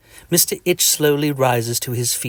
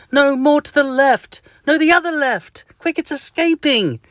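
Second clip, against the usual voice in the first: pleading itchy person; 3 dB louder than usual. Acted. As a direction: squeak out a desperate plea for a scratch. Itchy reader directing the scratch.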